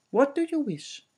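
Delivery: a sly unseen servant answering. Acted. Unseen voice at window.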